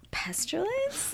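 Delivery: slow, high whispered question. Retold or imitated. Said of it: "she was like".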